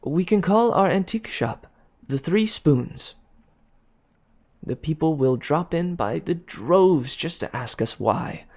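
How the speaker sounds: high, eager and bright-voiced; fiancée eagerly pitching the shop name. A narrator's reading. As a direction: rush the idea out eagerly. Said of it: "she added eagerly".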